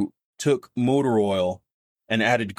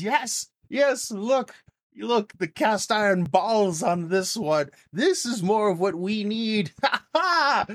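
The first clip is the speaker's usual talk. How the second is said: much higher, hammed-up boisterous fat man; fat man's praise; 11 semitones higher than usual. Fat man applauding Tyler's nerve, high and hammy. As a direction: bellow praise like a jolly boss. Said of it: "He says".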